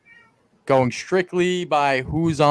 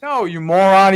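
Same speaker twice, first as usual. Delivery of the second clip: loud, higher-pitched scolding; mock exasperation; 5 semitones higher, 5 dB louder than usual. Co-host correcting a botched quote, mock exasperation.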